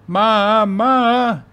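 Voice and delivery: high, very loud drawn-out wail; shouting, almost crying. A narrator's reading. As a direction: wail for your mother like an upset toddler. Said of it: "Carrie shouted, almost crying".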